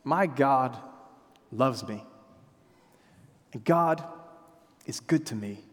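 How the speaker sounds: low, soft assurance; softly voiced confident faith; quiet confidence. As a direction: say it like a quiet certainty. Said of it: "could say with utmost confidence".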